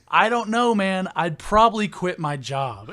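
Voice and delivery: very loud, high exclamation; exasperated outburst. Retold or imitated. Storyteller answering coworker's absurd scenario, exasperated outburst. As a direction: shout the answer, starting way up high.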